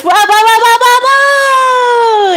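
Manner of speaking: high, loud tumbling whoas; exclaiming whoa. Acted. Boy tumbling down the hill, yelling whoa. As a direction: lose balance down a hill. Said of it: "Aiden exclaimed".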